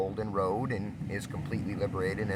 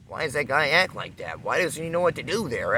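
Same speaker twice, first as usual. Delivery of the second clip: ordinary talk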